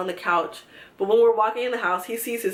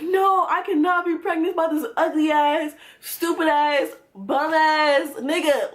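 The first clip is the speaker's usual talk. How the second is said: mock crying; 6 semitones higher, slower than usual. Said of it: "I was boo-hoo crying".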